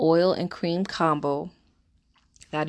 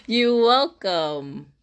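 very slow, high and loud, mock diva; milk it like a diva; over-the-top diva repeat; hammed-up you welcome; 6 semitones higher, 5 dB louder, much slower than usual. Real, not acted. Repeat after laugh.

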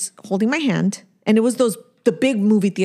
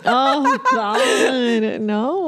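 high, laughing groan of protest; laughing dismay; 7 semitones higher, much slower than usual. Real, not acted. Mother reacting to talk of a grandchild, laughing dismay. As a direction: wail it through a laugh. Mock horror at baby joke.

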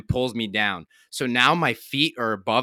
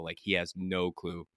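ordinary delivery

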